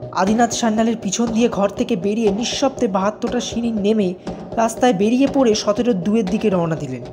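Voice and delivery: ordinary delivery, nothing marked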